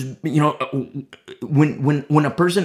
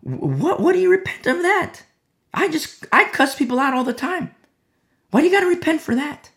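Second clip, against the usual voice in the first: high-pitched, louder mock disbelief; incredulous fellow believer; 12 semitones higher, 4 dB louder than usual. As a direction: act out a baffled objection to repenting. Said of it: "That other brother, that other sister is gonna be like".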